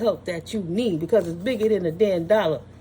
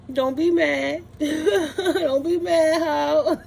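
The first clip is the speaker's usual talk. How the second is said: laughing taunt; 5 semitones higher, much slower than usual. Real, not acted.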